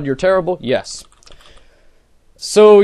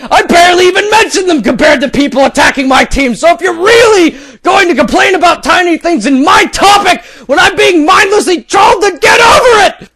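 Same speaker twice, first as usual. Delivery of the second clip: higher and louder, building to a shout; mock angry rant; 9 semitones higher, 5 dB louder than usual. Reading a troll's furious post aloud, mock shouting. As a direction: ham up the outraged poster.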